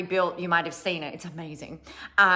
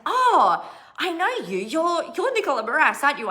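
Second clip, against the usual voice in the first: high, bright gush of recognition; excited recognition; 8 semitones higher, 4 dB louder than usual. Retold or imitated. Air hostess spotting her on the plane, excited and gushing. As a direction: gush over spotting someone famous.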